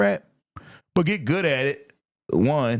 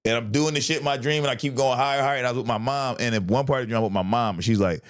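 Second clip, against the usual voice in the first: ordinary delivery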